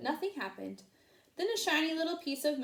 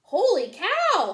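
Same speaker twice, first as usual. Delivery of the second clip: loud, slow, higher holler; 9 semitones higher, 11 dB louder, slower than usual. A narrator's reading. Manny the cook hollers in amazement. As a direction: holler it across the diner. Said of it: "hollered Manny the cook".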